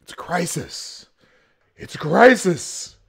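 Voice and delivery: hammed-up alarm, rising to a loud cry; mock fear-mongering. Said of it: "that's a fear tactic".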